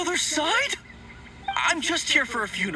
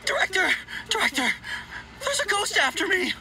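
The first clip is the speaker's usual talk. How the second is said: higher, frightened calling; panicked calling out; 8 semitones higher than usual. Acted. Undertaker flees to his director, panicked call. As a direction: run in crying for help.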